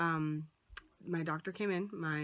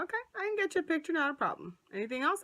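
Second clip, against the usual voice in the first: imitating the doctor; 9 semitones higher, faster than usual. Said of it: "He's like".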